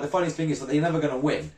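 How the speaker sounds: ordinary talk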